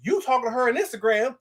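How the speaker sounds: normal talking voice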